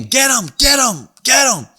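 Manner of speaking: rally the pack, get 'em; egging-on shout. Retold or imitated. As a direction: kids egging each other on in a high shout. Kids piling on, egging on.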